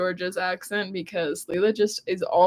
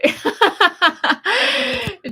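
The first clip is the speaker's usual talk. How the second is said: high burst of laughter; 12 semitones higher, 5 dB louder than usual. Real, not acted. Laughs at her try.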